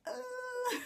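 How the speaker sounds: sudden very high, loud grunt; a sharp squeaky ugh of effort; effortful grunt. Retold or imitated. Slapping her boyfriend before running off, a high squeaky grunt. Grunt as she slaps and flees.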